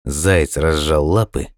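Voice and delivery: plain reading voice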